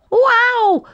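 a sudden high, loud yelp; yell of pain. A narrator's reading. Boxer yelling after striking the porcupine. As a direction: yelp like a stung cub. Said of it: "yelled Boxer".

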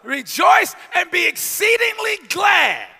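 slow, loud proclamation; preaching the verse. Acted. Preacher proclaiming the reward in heaven, slow. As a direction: stretch out every word.

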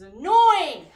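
sudden loud high complaint; annoyed shout. Real, not acted. Streamer venting about Twitch cutting her off, annoyed shout. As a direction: blurt it out exasperated.

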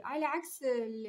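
ordinary talk

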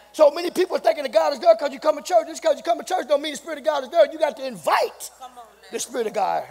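loud, high shouting preacher; rising into a full shout of preaching; preaching at full force. Acted. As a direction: hammer each point home to the church. Sermon climbs to full force.

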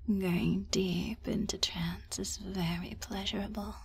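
normal talking voice

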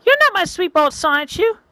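put-on a girl realizing she was grabbed; startled girl.

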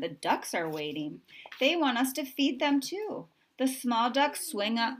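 ordinary delivery, nothing marked

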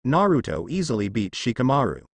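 plain reading voice

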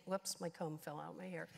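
ordinary talk

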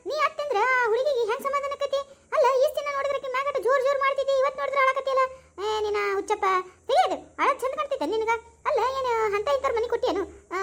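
high-pitched, exasperated chiding; scolding a crier. Acted. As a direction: scold him out of his tears. Scolding a crying man at farewell.

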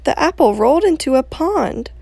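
put-on reading to children. Outdoor reading to children, animated storytelling. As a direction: tell it like a bedtime story.

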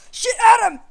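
very high, very loud panicked yell; alarmed scream. Real, not acted. Gamer warning friend Adam of attack, panicked scream.

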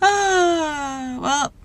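shaky. Real, not acted. Interviewee still shaken after the police scare. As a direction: let the nerves shake the sound. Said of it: "I'm shaking".

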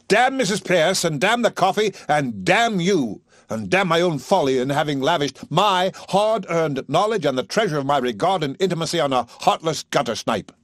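high, loud, slowing on each damn; furious cursing. A narrator's reading. Higgins cursing everything at Eliza, losing his temper. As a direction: spit out every damn in rage.

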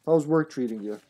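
usual speaking voice

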